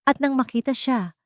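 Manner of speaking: usual speaking voice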